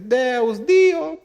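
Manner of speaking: Dio shouted up high; mock chorus of names. Preacher mimicking a crowd calling God's names, rising pitch. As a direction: call out Deus then Dio, climbing. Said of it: "uno empieza a decir".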